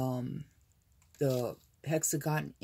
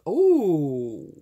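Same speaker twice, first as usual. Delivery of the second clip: excited burst; 10 dB louder than usual. Real, not acted. Overwhelmed by beauty.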